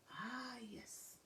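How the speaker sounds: airy unvoiced whisper; a breathy hushed yes; whispered quiet agreement. Annalie answers in hushed reply.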